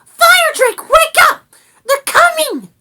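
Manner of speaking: very high, loud alarm; frantic little rat sounding the alarm; urgent shout. A narrator's reading. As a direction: yell to rouse a sleeper. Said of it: "she shouted".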